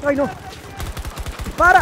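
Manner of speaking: loud, high command; urgent shout. Real, not acted. Player in a firefight yelling high and loud to shoot. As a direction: yell it at the screen.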